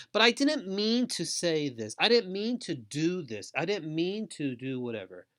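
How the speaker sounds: acted defensive listener; defensive self-explaining. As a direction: make excuses, pleading your own case. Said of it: "explaining your own situation".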